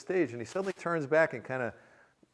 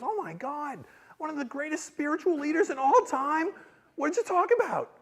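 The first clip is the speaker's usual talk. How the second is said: high, rising excitement; acted-out thrilled amazement; awestruck wife; 11 semitones higher, 5 dB louder than usual. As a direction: gush and ask eagerly. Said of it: "my lovely bride says".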